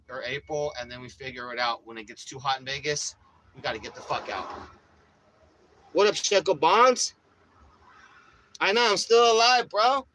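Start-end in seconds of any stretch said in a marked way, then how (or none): 5.9-10.1 loud and high, pumped to say hi; big hype-man vlog opener; hyped loud greeting. Real, not acted. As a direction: shout it out like you're back from the dead.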